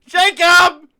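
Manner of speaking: very high, loud frantic yelling; panicked shouting. Acted. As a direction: scream the name in panic.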